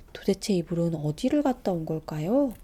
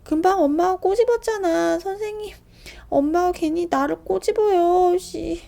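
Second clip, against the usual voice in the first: put-on high, loud tattling child; tattling loudly; 10 semitones higher, 8 dB louder than usual. Little brother telling on mother to the teacher, loud high. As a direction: call out to tell on mom. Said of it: "큰 소리로 이릅니다".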